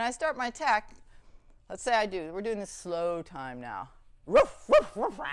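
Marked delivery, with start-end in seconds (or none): 4.3-5.3 sharp high barks, loud at first, then dropping back; aggressor dog barking. Pretend. Trainer playing the aggressor dog in a demo, barking high and loud. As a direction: bark like the attacking dog. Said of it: "I am the aggressor dog".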